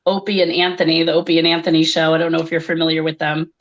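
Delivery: usual speaking voice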